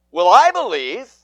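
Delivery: loud, high, bold proclamation of faith; bold cry. Acted. Father boldly crying out his faith to Jesus, loud. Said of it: "cried the father".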